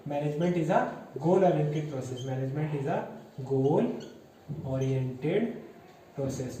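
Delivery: measured, broken up with pauses between chunks; word-by-word dictation. Shown as a demonstration. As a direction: dictate it to a class, pausing before each key word. Dictating the key point word by word. (रुक-रुक कर लिखवाते हुए)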